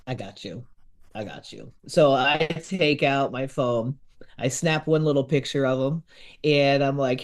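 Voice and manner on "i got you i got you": much softer and lower, reassuring; soft reassurance to the guide. Retold or imitated. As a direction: hush him kindly, I've got this.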